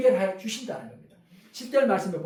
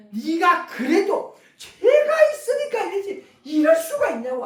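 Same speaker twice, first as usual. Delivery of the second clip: high, loud, drawn-out accusation; accusing protest; 8 semitones higher, 5 dB louder, slower than usual. Retold or imitated. Job's friends insist he must have sinned, accusing protest. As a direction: imitate friends hurling blame, voice pitched up. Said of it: "친구들이 와가지고 항변을 얼마나 많이 합니까".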